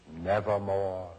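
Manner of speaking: low, deep answer from the bird; raven's reply. A narrator's reading. Raven answers the grieving narrator, low and ominous. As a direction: intone it like a dark raven.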